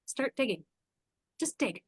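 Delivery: soft, measured instruction voiced as the therapist; retold therapist advice. Therapist tells her client to dig, quiet and deliberate. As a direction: quietly give the order, pausing between. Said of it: "she's the one that said".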